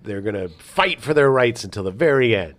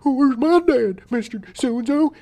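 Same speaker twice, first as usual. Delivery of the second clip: put-on very high pleading child voice; mock a boy asking after his dad; imitated kid; 14 semitones higher, 3 dB louder than usual. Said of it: "you know".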